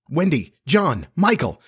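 high-pitched, urgent plea at normal volume; crying out. A narrator's reading. The three children beg Peter to send her away, crying out. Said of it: "the three cried simultaneously".

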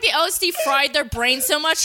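ordinary talk

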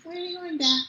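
high, soft imitation of a small child; tiny imitated toddler voice; little boy's voice. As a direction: ask softly like a little kid. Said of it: "to hear that little voice".

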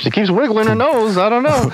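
high sitcom narrator voice; playful high announcer. Acted. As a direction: play along as a vintage narrator. Joins the announcer voice.

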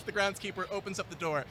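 ordinary delivery

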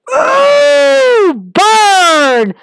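a big high "ooh" shouted out, then "burn!"; excited whoop. Real, not acted. Vlogger cheering Roman's burn on Cena, excited whoop. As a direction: cheer the insult like a fan in the crowd. Reacting to a major promo burn.

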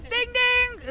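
high, loud ding-ding bell imitation. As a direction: chime like a quiz bell. Quiz host making bell sound.

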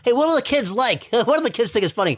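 mock-enthusiastic idea pitch; mock clueless writer. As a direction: lightly act out someone pandering to the kids. Acting out the brunch line's writer.

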